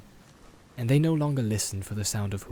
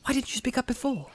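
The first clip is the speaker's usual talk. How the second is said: surprised Frodo; 12 semitones higher than usual. Acted. Frodo voiced.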